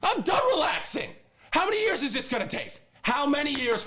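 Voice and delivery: high-pitched exasperation; worked up. Real, not acted. Fan venting to his co-host Paul, high and worked up. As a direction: demand an answer at the end of your patience. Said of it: "getting worked up about the Jets".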